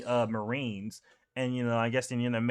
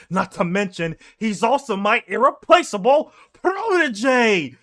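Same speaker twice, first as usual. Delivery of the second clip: proud battle cry; 8 semitones higher, 6 dB louder than usual. Retold or imitated. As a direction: swell with pride as you charge. Garp charging with pride.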